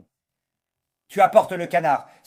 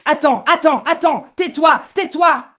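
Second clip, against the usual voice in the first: furious shout; 7 semitones higher, 6 dB louder than usual. Acted. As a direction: shout him down to shut him up. Cornel bursts into the accusation.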